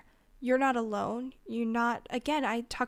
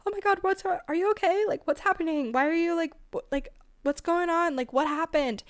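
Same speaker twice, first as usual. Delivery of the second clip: much higher and louder, frantic alarmed questions; panicked freaking out; 6 semitones higher, 4 dB louder than usual. Shown as a demonstration. Bad helper shrieking at someone having a panic attack, frantic and high. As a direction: play the friend who panics and makes it worse. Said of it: "not screaming".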